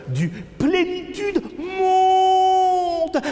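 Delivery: high, slow drawn-out cry; theatrical wailing chant, very high; wailed refrain. Acted. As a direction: soar up and hold the last word. Refrain cried out in poem.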